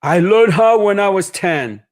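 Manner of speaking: lightly voiced shoemaker recalling his youth; cobbler. Cobbler telling the boy he learned at ten, lightly voiced. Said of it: "he says".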